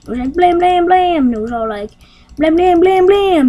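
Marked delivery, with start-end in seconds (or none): 0.3-1.3 higher, louder rapid-fire blams; imitated blasts. Imitates blasts in chaotic story. 2.4-3.5 higher, louder rapid-fire blams; imitated blasts. Imitates blasts in chaotic story.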